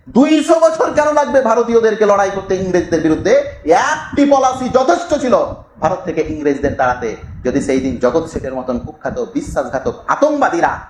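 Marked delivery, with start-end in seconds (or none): 0.1-7.2 high, forceful rhetorical challenge; impassioned oratory. Retold or imitated. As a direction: declaim the quoted words high and hard. Impassioned quote of a writer. (আবেগময় উঁচু গলায় জোরালো ভাষণ)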